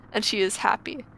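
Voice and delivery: usual speaking voice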